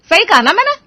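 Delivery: nagging mother's voice, loud and sharp; scolding mother. Acted. Mother scolding her sleeping son, nagging loudly. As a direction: tear into a lazy son at dawn.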